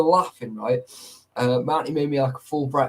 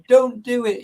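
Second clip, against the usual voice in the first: put-on kid's outburst; 9 semitones higher, 6 dB louder than usual. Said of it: "was like".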